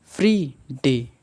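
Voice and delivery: Free punched loud before डे; stressed free. Shown as a demonstration. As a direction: hit free hard, then डे. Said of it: "Friday सबसे आसान है". (Free पर ज़ोर देकर free डे)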